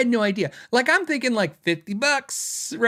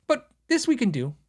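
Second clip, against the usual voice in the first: higher, bright eager salesman voice; chirpy can-do rep; cheerful factory rep catchphrase; Warwick staff impression; 7 semitones higher than usual. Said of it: "I love doing this impersonation".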